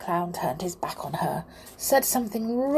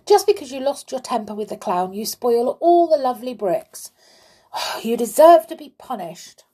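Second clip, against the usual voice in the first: raised, indignant telling-off; reproachful, quick at first then emphatic; scolding; 5 semitones higher, 5 dB louder than usual. A narrator's reading. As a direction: scold a naughty child, building louder. Tom scolds Amelia for spoiling bricks.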